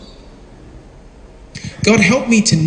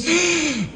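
mock gasp; 9 semitones higher, 6 dB quieter than usual. Disciples panic in storm.

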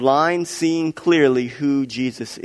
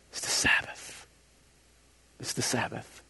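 scandalized whisper, much softer; hushed worry; 4 semitones lower, 13 dB quieter than usual. Acted. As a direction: whisper it as the Pharisees' anxious aside. Pharisees fretting over the Sabbath.

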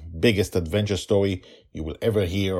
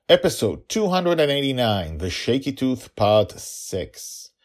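raised, ringing title read; episode announcer; 7 semitones higher, 5 dB louder than usual. Pretend. Host opening the story reading, announcer voice. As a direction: announce it brightly with a lifted pitch.

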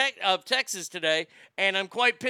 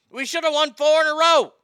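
angry outburst; 7 semitones higher, 8 dB louder than usual. Real, not acted. Cowboys rant.

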